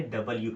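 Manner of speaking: डब्ल्यू in full as "da-bal-yoo"; three-part "double-you" for डब्ल्यू; correct pronunciation. Shown as a demonstration. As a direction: keep the y-sound in डब्ल्यू. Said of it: "ये कैसे लिखे".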